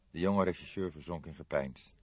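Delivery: usual speaking voice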